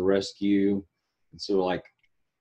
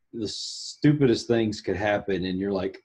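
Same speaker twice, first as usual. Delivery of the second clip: normal talking voice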